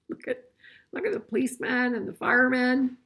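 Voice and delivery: ordinary delivery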